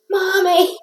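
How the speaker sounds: high mock scream of a scared child; kids screaming. Kids in the back seat scream for mom, high scared shriek. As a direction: shriek it like a frightened kid. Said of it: "they would scream".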